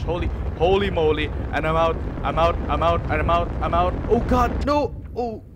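loud, high frantic bailing out; loud panic climbing to a high yell; panicked retreat. Real, not acted. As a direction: shout it in rising panic as you flee. Flees a burning tire.

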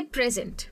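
"Present" with PREZ punched, ENT fading; "present" as a noun, front-stressed; stress on first syllable. Shown as a demonstration. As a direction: hit the PREZ of "present", let the end drop. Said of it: "praise पे ज़्यादा stress".

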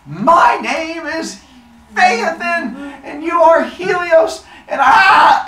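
high, shouted, drawn-out hero's announcement; hammed-up bold proclamation breaking into a scream; grand challenge. Phaethon bursts in to confront Helios, grand shouted challenge.

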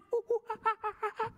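high hooting monkey giggle; high silly monkey laugh. Pretend.

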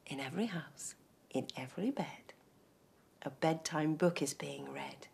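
soft hushed bedtime whisper. A narrator's reading. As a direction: whisper them off to sleep. Bedtime ending hush.

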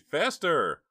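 high, loud urging; frantic high-pitched yell; urgent shriek. A narrator's reading. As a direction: shriek to hurry them on. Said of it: "shrieked Jade".

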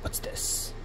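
unvoiced whisper. Real, not acted. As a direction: breathe the question. Whispering while exploring menu.

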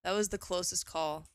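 ordinary delivery

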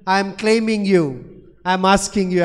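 usual speaking voice

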